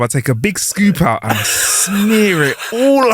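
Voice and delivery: drawn-out, deliberate line reading; slow, emphatic joke line; slow punchline delivery. Shown as a demonstration. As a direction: savour each word slowly. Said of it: "let me do the line like that".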